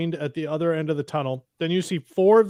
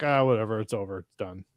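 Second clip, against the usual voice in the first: dismissive, sinking softer and lower; tossed-off, couldn't-care-less imitation; mock indifference; 5 semitones lower, 4 dB quieter, faster than usual. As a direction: shrug it off as an uncaring crew would. Voicing crew who didn't care.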